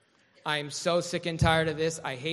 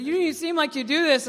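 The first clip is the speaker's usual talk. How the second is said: cop's voice; 11 semitones higher, 9 dB louder than usual. Retold or imitated. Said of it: "And he said".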